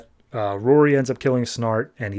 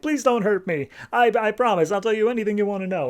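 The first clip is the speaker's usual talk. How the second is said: higher, fast cowering plea; mock pleading; 8 semitones higher, faster than usual. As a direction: beg like a coward, tongue in cheek. Hal grovels at his feet.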